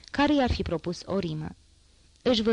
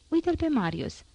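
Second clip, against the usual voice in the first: excited exclaim; 9 semitones higher than usual. A narrator's reading. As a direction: pitch jumps high, pointing out a friend. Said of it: "exclamă Bossuet".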